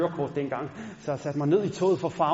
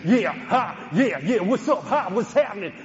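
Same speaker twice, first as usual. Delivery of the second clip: hammed-up rapper swagger, louder; mirror rapper; 3 semitones higher, 5 dB louder than usual. Teen at the mirror imitating rappers, loud swagger. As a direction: play the cool rapper in the mirror. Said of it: "stod derhjemme foran spejlet og sagde".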